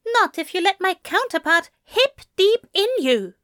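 high-pitched, pointed angel voice; prim, wounded angel; miffed angel. A narrator's reading. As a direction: pitch it up, indignant.

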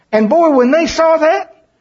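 high, slow, building excitement; excited storytelling. Real, not acted. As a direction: paint the awe big and slow.

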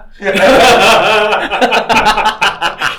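loud burst of laughter. Real, not acted.

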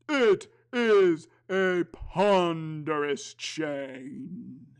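high, hollow ghostly moan; a weary ghost, slow and heavy. Acted. Ghost speaks, Marley.

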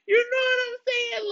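laughing. Acted. Mock upset, laughing while telling.

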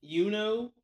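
Yuno said with a rising ending; Yuno, clearly as you-no; correct name Yuno. Shown as a demonstration. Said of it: "Not Yuna".

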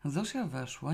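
ordinary delivery

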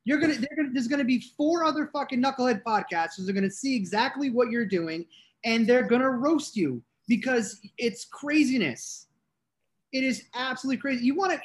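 high, heated disbelief; worked-up rant. Real, not acted.

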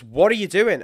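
imitating the wife's startled yell; alarmed retold shout. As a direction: bark it like a startled wife. Wife startled by sudden braking.

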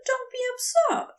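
an angel bluffing that he's fine; high, flustered insistence; lying angel. A narrator's reading. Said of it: "Aziraphale lies".

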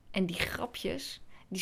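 slow hushed whisper. Acted. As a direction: whisper it like a secret. Hushed aside about the jokes.

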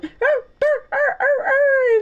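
high-pitched barks, in a row; dog barking. A narrator's reading. Dog answers the grandfather on the phone, high barking. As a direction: bark like an excited little dog. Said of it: "answered the dog".

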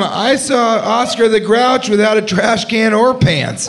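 lightly voiced kid tugging at mom. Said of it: "And the kid's like".

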